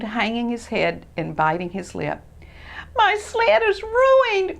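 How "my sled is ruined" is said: high, slow tearful wail; on the verge of crying; near tears. Acted. Christopher almost crying over his ruined sled. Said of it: "almost ready to cry".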